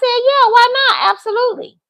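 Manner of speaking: breezy assurance. Retold or imitated. Woman telling him she's going with a friend, breezy high assurance. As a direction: answer high and airy, totally unbothered.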